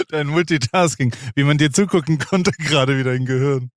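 amused, laughing through the words. Real, not acted. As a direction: chuckle while teasing. Laughs at friend's multitasking.

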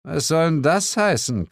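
miffed challenge; growled annoyance. A narrator's reading. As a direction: demand an explanation, mildly growling. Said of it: "knurrte Suko".

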